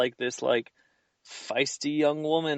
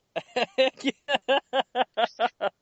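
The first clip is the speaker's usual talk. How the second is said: high-pitched laugh; 12 semitones higher than usual. Real, not acted. Laughing at the chef bit.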